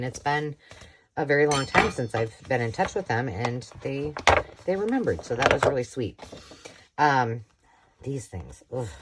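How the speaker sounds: normal talking voice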